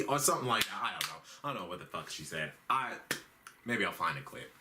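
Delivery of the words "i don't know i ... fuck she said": much softer, fast mutter; mumbled aside. Real, not acted. Comic muttering that he doesn't know what she said. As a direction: throw it away under your breath.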